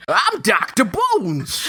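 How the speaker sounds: high, slow cartoon doctor voice; goofy doctor voice. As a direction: drag out "I'm Doctor Bones" in a silly put-on voice. Playing a goofy Doctor Bones.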